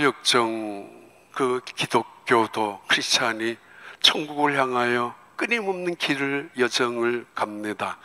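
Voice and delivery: soft, unhurried narration; quiet storytelling. A narrator's reading.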